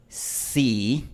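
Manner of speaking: sí with a glide at the end, English style; Spanish sí. Shown as a demonstration. Teacher showing the glide heard on sí, demonstrating. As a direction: say sí letting it slide into a glide. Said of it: "En la palabra".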